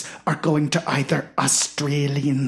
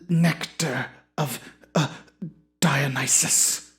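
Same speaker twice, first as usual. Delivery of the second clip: pompous Shakespearean actor; 3 semitones lower, 3 dB quieter than usual. Acted. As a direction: savour every word like a self-important thespian.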